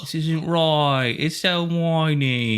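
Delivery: put-on high, slow, whining British accent; whiny English accent. Mocking how the British whine, whiny English accent. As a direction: whine it out as a caricature Brit. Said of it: "Yeah, they do whine".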